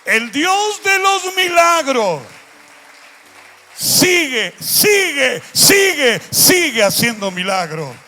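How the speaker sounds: preaching at the top of your lungs, drawing out each "sigue"; thunderous sermon cry, building on the repeats; full-force preaching. Real, not acted. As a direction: shout it out, slow and high, like a revival preacher. Preaching the miracle God.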